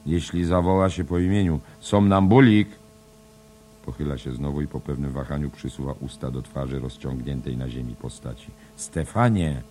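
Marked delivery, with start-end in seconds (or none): 0.1-2.7 loud, higher, rising to a shout; calling out. Acted. Trying to wake Stefan. 8.8-9.6 louder call of the name. Acted. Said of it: "Woła raz jeszcze".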